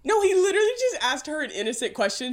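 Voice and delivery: normal talking voice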